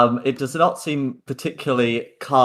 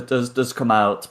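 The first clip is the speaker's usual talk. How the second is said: usual speaking voice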